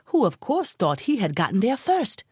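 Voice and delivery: plain reading voice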